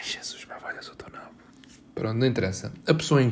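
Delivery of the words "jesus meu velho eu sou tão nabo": whispered self-scolding, barely audible; embarrassed whisper. Real, not acted. Host whispers after giving away the secret, mortified. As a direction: hiss it to yourself, mortified. Mutters after revealing it's a girl.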